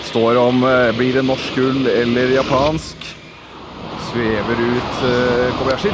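tense live sports call; ski jump commentary. Real, not acted.

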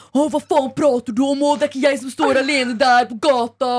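imitating an indignant guy taking it personally; put-on affronted rant; mock offended. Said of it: "tok det veldig sånn nært seg".

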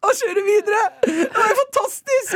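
very high, squeaky voice through laughter; cracking up, almost crying; helpless laughter. Real, not acted. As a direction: barely get it out for laughing. Helpless laughter at own story.

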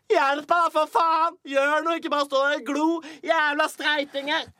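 furious shout at bystanders on Oslo S; shouting for help. Retold or imitated. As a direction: yell at the crowd to do something. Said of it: "Roper sånn til folk".

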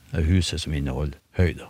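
normal talking voice

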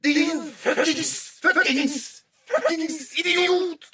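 very high, slow strained screech; shouting thoughts. Acted. Father's thoughts shouting at himself, high strangled yelling. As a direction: scream each insult inside your own head. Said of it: "ropte tankene mine inne i hodet mitt".

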